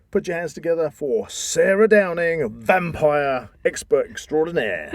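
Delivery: showman announcement. Acted. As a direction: announce her like a star guest. Announcing the guest like a host.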